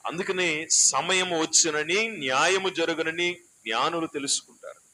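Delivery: solemn thundering sermon line; declaiming preacher. Acted. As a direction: proclaim it to the hall. Preaching at full force.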